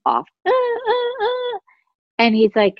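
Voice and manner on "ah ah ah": very high, repeated imitated alarm beep; alarm going off. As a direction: mimic an alarm with even high bursts. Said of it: "the alarm goes off".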